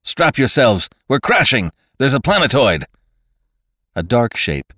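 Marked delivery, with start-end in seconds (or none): 0.0-2.9 loud, high urgent warning; raised, alarmed voice; cried out. A narrator's reading. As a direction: shout orders before a crash. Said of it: "cried the man".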